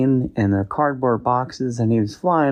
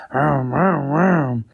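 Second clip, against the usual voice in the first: higher, playful engine vroom noises; excited engine vrooms; 5 semitones higher, much slower than usual. Acted. A kid on a bike stand, making higher engine vrooms. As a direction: rev it up like a kid racing.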